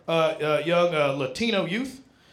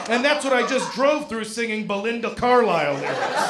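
ordinary talk

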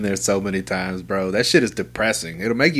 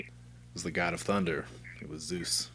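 hushed mock mystery; 5 semitones lower, 15 dB quieter than usual. Joking mythic aside.

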